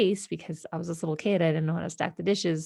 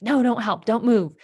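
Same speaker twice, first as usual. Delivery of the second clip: snapped order; 4 semitones higher, 6 dB louder than usual. Retold or imitated. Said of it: "she just snapped at me".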